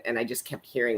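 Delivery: ordinary talk, nothing marked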